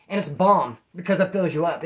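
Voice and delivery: usual speaking voice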